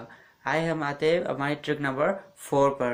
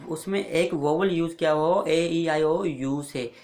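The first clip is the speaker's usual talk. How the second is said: ordinary talk, nothing marked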